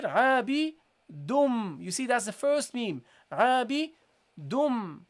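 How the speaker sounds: عابدون drawn out slowly, meme by meme; slow demo. Shown as a demonstration. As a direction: break عابدون into parts, slowly. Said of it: "So you go here".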